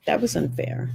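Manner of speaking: soft whispered repeat of "That was unfair". Real, not acted. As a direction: breathe it out as a whisper. Repeating in regret.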